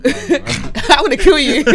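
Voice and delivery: slow threat through laughter; joking threat, laughing; laughing mock threat.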